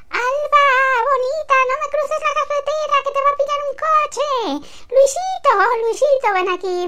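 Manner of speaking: voz de madre mucho más aguda y rápida; llama a los críos a voces agudísimas; madre gritando a los niños. Acted. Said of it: "entonces la mamá". (madre a la salida del cole, muy aguda y apresurada)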